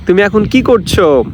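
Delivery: ordinary talk, nothing marked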